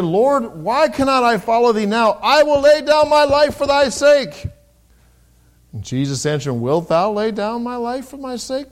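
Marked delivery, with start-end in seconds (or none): 0.0-4.5 much higher, louder, slow pleading vow; impassioned Peter. Acted. Peter pleads with Jesus, loud and high. 6.5-8.7 higher, slow questioning reading; solemn scripture reading. Acted. Jesus answers Peter, slow and searching. Jesus's reply read aloud.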